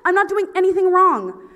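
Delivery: high, loud indignation; defensive protest. Retold or imitated. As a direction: blurt out your innocence to the officer. Unspoken protest to the cop.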